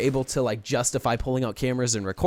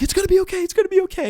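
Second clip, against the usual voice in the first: very high mock-soothing voice; imitating friend soothing; 5 dB louder, faster than usual. Mimicking a friend calming him down, in a very high mock voice. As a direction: parrot the friend's frantic comfort, way up high. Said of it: "he's just like".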